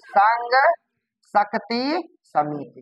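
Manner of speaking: word by word with long pauses; dictating. Shown as a demonstration. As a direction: give the name slowly for the class to catch. Teacher repeats name for class.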